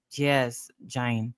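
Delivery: normal talking voice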